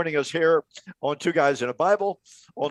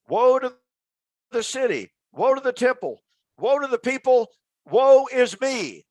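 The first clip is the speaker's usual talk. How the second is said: retold screaming; 7 semitones higher than usual. Host voicing the prophet crying from the wall, high mock wail. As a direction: wail the warnings like a man gone mad. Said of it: "literally screaming".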